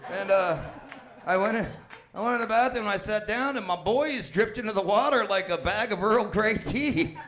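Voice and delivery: ordinary talk, nothing marked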